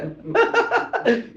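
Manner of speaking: high-pitched staccato ha-ha laugh; gleeful laugh. Acted. Beggar gets diamond and coins back, gleeful laugh.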